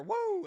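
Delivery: high loud wrestler woo; piercing Ric Flair woo. Pretend. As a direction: scream a Nature Boy woo.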